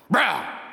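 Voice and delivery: short high roar; sudden mock roar. Aggressive guy undercutting his teddy bear look, mock roar.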